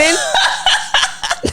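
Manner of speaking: amused high laugh. Real, not acted. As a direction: burst out laughing.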